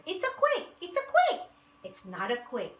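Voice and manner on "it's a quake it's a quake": high, loud panicked cry; shouting. A narrator's reading. Animals shouting in panic as the earth rumbles. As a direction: yell it out in alarm. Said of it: "they all shouted".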